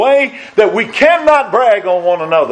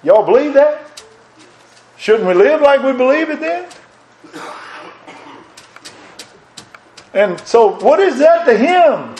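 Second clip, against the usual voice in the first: higher-pitched preaching fervor; preacher climb; 9 semitones higher than usual. Acted. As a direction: lift it up high to the congregation. Preacher rising to a high pitch.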